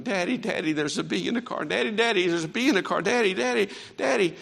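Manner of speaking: a child calling out in fright, lightly acted; retold frightened screaming. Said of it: "started screaming".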